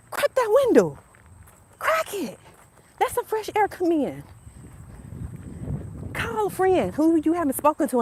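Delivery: high, eager urging; excited urging. Real, not acted. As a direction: push them to open up. Urging viewers, voice jumps high.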